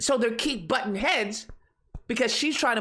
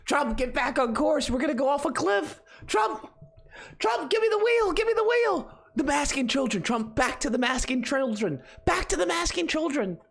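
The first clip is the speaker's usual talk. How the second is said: frantic pleading; 5 semitones higher than usual. Pretend. Pleading with Trump to steer back.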